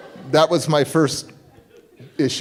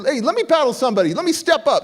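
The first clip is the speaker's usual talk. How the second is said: eager challenge; 8 semitones higher, faster than usual. Retold or imitated. Asks to battle.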